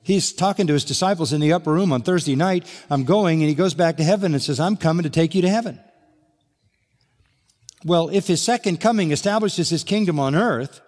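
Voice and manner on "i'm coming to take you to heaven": ordinary talk, nothing marked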